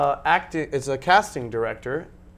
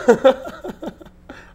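high burst of laughter; 13 semitones higher, 9 dB louder than usual. Real, not acted. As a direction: laugh at yourself. Laughs at himself.

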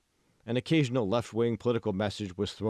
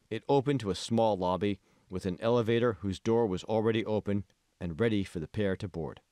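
plain reading voice

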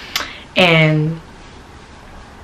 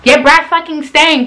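mock brush-off; 7 semitones higher than usual.

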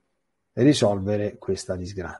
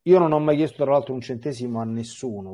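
normal talking voice

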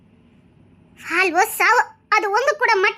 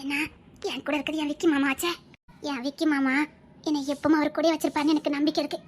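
much softer and lower, devoted; tender reassurance; 5 semitones lower, 7 dB quieter than usual. Acted. Wife comforting frightened husband, soft and tender. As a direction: soothe him gently.

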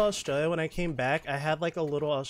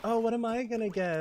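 higher, hopeful wondering; retold eager anticipation; 8 semitones higher, 3 dB louder than usual. Traveller awaiting her award at debrief, retold eager anticipation. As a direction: wonder aloud, pitched up.

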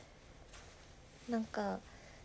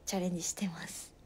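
shy whispered confession; 4 semitones lower, faster than usual. Real, not acted. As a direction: whisper it, a little embarrassed. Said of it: "イベントにチャレンジしてて、". (照れてささやく)

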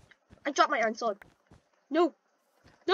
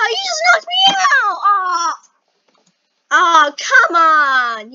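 much higher, loud cry of protest; outraged shout; 3 semitones higher, 11 dB louder, slower than usual. Real, not acted. Gamer yelling at a friend who knocked him out, outraged. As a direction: yell it like you just got robbed.

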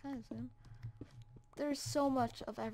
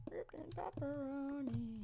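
whisper; 9 dB quieter, slower than usual. Acted. Mock solemn loss in game.

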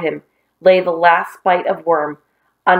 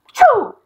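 sudden high-pitched "choo"; hammed-up sneeze; pretend sneeze; 3 dB louder than usual. Acting out a sneezing fit.

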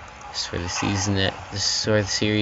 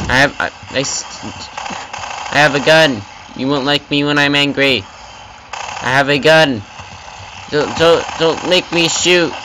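loud, higher mock-tough threats at enemies; 4 semitones higher, 4 dB louder than usual. Gamer yelling mock threats at enemies, loud. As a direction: bluff them like an action hero, loud and high.

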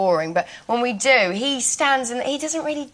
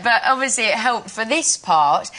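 ordinary delivery

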